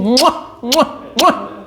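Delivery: mock air kisses, rising in pitch; blown kisses. Flatterer showers the donor with air kisses, mock smacking.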